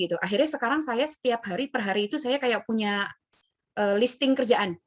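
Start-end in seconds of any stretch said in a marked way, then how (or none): none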